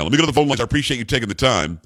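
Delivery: normal talking voice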